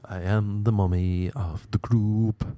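slow, drawn-out self-important announcement; slow mock proclamation. Girl joking to her friends, slow mock proclamation. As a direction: spell it out word by word, slowly. Repeats it slowly, playing the mummy.

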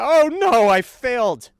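hammed-up wail of defeat; mock despair. Mocking unmasked guy again.

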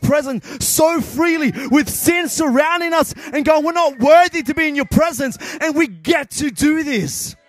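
high, driving, fervent preacher voice; full-force preaching. Real, not acted. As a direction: preach it hard with rising urgency.

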